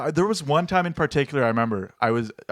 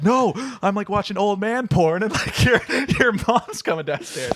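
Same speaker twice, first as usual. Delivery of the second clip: freaking out; 7 semitones higher than usual. Retold or imitated. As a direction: rush it out in a panic. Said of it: "I was freaking out".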